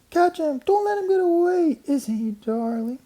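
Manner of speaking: put-on very high, excited girls' squeals; squealing girls. A narrator's reading. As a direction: squeal with delight at the mouse. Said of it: "all the girls were squealing".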